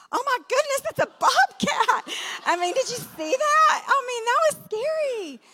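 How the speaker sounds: high, put-on voice of a startled husband; husband's shocked voice. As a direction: play the stunned husband. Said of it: "And then he's like".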